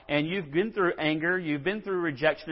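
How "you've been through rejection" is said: usual speaking voice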